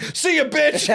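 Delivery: high, loud mock call; gleeful taunt. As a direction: shout it back like a kid speeding off. Imitates kid speeding away, taunting.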